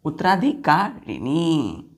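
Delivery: उत्तराधिकारिणी drawn out slowly, syllable by syllable; slow model word. Shown as a demonstration. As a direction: stretch out उत्तराधिकारिणी for children to copy. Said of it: "teacher models the word".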